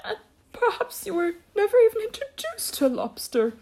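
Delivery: very high creature voice; high weepy turtle voice; tearful Mock Turtle. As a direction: pitch it way up, a tearful turtle talking. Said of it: "with tears running down his cheeks".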